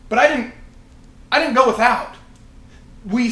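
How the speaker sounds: high, loud insistence; emphatic preaching shout. Real, not acted. Preacher to his group, loud emphatic insistence. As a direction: drive it home to the congregation.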